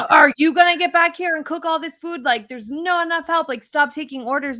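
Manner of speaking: hammed-up angry chef yelling; imitating screaming chef. French chef yelling at the new hostess, mock angry. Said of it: "screamed at me and said".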